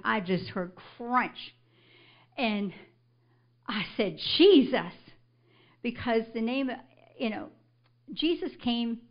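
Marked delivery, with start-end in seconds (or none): 4.4-4.9 one loud, falling cry for help; retold loud cry. Woman calling out as she hits the concrete, loud retold cry. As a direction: call out the name, higher and louder. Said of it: "I just said, Jesus, as loud as I could".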